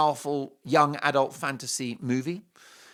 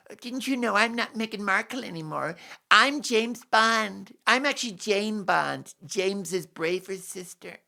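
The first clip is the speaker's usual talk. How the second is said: higher-pitched mocking impression; Meghan Markle; 6 semitones higher than usual. Imagining herself as James Bond, mocking impression. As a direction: put on a self-important, deluded voice. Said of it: "she probably thinks she can be James Bond".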